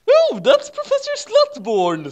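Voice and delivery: hammed-up shrill cartoon devil; squeaky Satan. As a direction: play Satan as a silly high-pitched goth. Satan voiced by reader.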